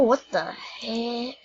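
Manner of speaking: usual speaking voice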